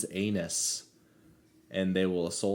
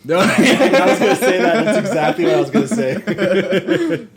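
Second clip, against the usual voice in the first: laughing; 12 semitones higher, 16 dB louder than usual. Real, not acted. Laughing at the shared joke.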